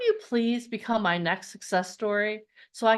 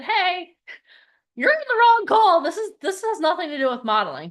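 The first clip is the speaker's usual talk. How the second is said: mock call-out; 6 semitones higher, 4 dB louder than usual. Imagined scolding call.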